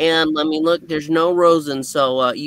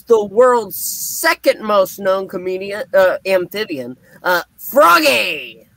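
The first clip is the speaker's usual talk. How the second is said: big introduction; 4 semitones higher, 4 dB louder than usual. Acted. Host introducing co-host to viewers, ringing announcer cry. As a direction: draw out the name like a ring announcer.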